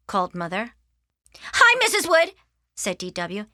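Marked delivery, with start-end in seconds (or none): 1.5-2.4 high, loud, piping greeting; kid's chirpy hello; eager little sister. Acted. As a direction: pipe up cheekily to a grown-up. Little sister pipes up to visitor.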